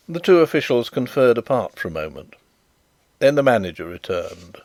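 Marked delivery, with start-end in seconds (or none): none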